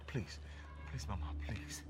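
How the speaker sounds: soft breathy whisper begging; whispered plea. Acted.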